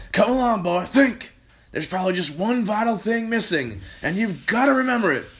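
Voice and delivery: high, loud, insistent voice; impatient Mister Cranky. Acted. Mister Cranky pressing George to remember, loud urging. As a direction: press him hard to think.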